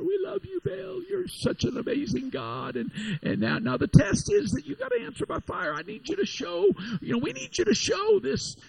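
a fawning false prophet begging his idol; mocking Baal prophets. Prophets of Baal begging their god, hammed-up high pleading. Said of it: "they're calling out, saying".

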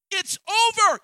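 emphatic declaration. Acted. Preacher as God telling Joshua to move on, ringing cry. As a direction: slam the door on the past.

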